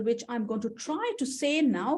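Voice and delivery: ordinary talk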